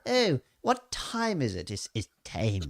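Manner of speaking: put-on Dorset accent, time said like team; drawled Dorset accent with jaw out. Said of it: "It must be our Dorset dialect".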